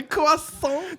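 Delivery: high squeaky copy of croissant; mock Carl voice. Copying the meme word back in a high voice. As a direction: echo the cartoon kid.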